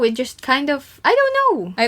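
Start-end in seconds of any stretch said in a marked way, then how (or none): 1.0-1.7 a high, exasperated I don't know; squeaky high I don't know. Real, not acted. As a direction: jump way up in pitch on the second I don't know. Repeats answer, much higher.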